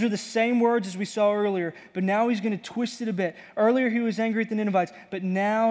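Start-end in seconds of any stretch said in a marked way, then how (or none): none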